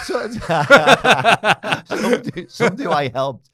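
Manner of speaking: high, loud laughter through the words; fighting to talk through laughter; laughing. Real, not acted. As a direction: laugh it out before the answer. Laughing at the pay joke.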